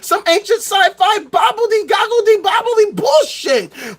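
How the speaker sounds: mock the nonsense with contempt; mocking rant. Real, not acted. Sneering at the magic concoction sellers, mocking shout.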